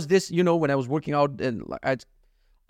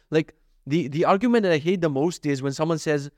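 ordinary talk

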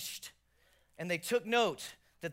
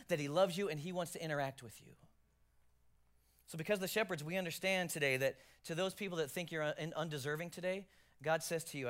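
ordinary talk

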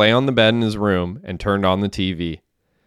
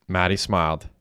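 ordinary delivery, nothing marked

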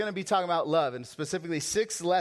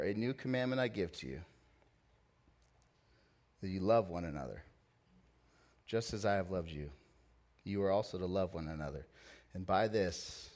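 scripture reading; 7 semitones lower, 5 dB quieter than usual. Acted.

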